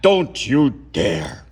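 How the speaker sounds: sharp, slow, loud snap; snapped. Acted. Strict hunch snaps at the boy to stop. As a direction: snap it like a stern warning. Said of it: "a voice snapped".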